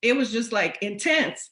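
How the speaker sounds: ordinary delivery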